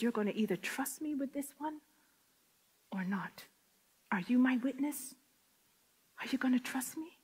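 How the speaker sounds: soft whisper, nearly breathless; quiet, hushed divine voice; hushed voice of God. Retold or imitated. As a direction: whisper it like a holy secret. God's voice quoted in hush.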